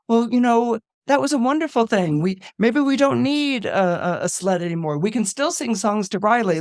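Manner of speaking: chirpy, look-on-the-bright-side pep; upbeat Joy. Retold or imitated. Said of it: "Joy comes and says".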